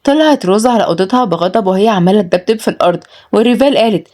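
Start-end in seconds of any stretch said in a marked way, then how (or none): none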